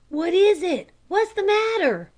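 alarmed at the commotion; startled. A narrator's reading. Scoutmaster suddenly woken.

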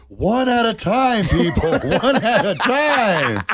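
slow mock announcer. Jokes as stomach.

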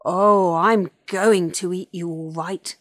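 big, loud dinosaur threat; wicked villain voice; menacing predator. A narrator's reading. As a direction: relish the threat, loudly.

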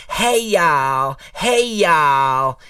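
high twangy Southern "Hey, y'all"; mock Southern accent. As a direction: imitate Hillary putting on a Southern accent. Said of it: "her accent would change".

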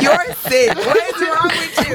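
high-pitched, laughing disbelief; amused outrage, stretched out and laughing; laughing scolding. Real, not acted. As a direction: scold through real laughter, voice way up. Cracking up at the joke.

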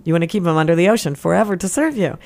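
usual speaking voice

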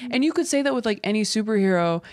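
ordinary talk

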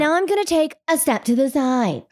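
news reporter voice. Retold or imitated. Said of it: "It's the news reporter voice".

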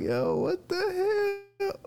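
high, drawn-out mock wail; baffled disbelief. Reacting as she snapped on him, wailing in disbelief. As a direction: stretch it into a squeaky whine of disbelief.

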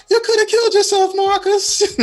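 put-on high scolding Jamaican accent; Jamaican grandma accent. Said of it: "the only way I can do her accent".

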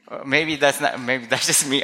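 normal talking voice

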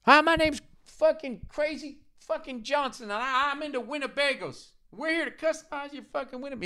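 hammed-up Discovery Channel builder voice; reality TV host. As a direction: lampoon a cable customizing-show host. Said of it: "people building shit".

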